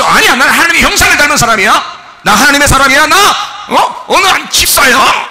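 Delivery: loud, high confident proclamation; proud believer shouting back; bold declaration. Acted. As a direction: declare your worth to the devil. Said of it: "이렇게 내려는데".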